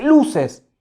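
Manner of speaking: indignant call. Acted. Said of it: "se levanta indignado".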